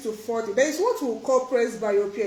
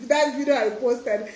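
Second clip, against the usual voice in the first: ordinary talk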